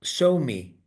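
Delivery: ordinary talk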